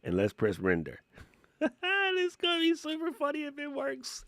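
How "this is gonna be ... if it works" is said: high squeaky giggle through the words; laughing it out, barely holding it together; giggling. Real, not acted. Excited about his voice test, talking through giggles. Giggling while the render starts.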